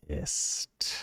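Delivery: whispered narration of contained anger; hushed anger. A narrator's reading. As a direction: drop into a tense, drawn-back whisper. Narrator voices character's seething anger.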